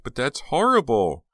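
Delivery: slow, high, loud horror; drawn-out horrified exclamation; shrieking. A narrator's reading. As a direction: cry it out, appalled. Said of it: "Jade shrieked".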